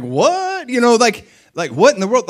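high, loud, baffled reaction; astonished outburst. Acted. Onlookers reacting to an imagined alien-like Jesus, astonished. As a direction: recoil in mock disbelief.

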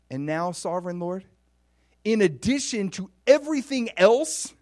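high, loud, incredulous questioning; blown away. Acted. David questioning the Lord's generosity, building amazement. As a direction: build from wonder to astonished disbelief. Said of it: "he's just blown away".

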